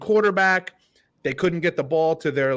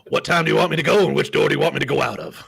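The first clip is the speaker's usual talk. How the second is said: imitating Ed Orgeron; 4 dB louder, faster than usual. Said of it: "how did he say".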